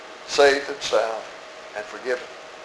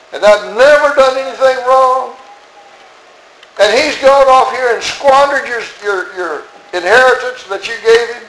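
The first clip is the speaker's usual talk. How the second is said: aggrieved protest; 5 semitones higher than usual. Acted. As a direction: list your wrongs like a cheated son. Elder brother's protest to father.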